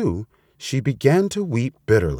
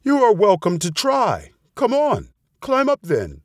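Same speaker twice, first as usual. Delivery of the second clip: high-pitched taunting challenge; daring; 11 semitones higher than usual. A narrator's reading. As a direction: lightly goad as a confident storybook bird. Bird dares the fox to climb.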